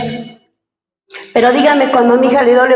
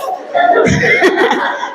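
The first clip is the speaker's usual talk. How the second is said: laugh. Real, not acted. Laughing at her own story.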